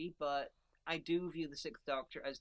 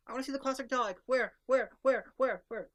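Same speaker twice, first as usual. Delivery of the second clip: high, loud fan outburst; exaggerated eager searching; impatient demand; 8 semitones higher, 10 dB louder than usual. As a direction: fire off each repeated word like a separate demand. Acts own impatient hunt for Dalek.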